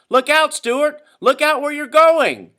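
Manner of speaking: very high, loud, slowing urgent yell; urgent warning. Acted. Someone on shore warning Stewart, very high loud yell. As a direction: scream the warning across the water.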